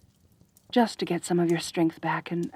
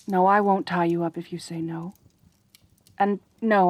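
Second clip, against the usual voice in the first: ordinary talk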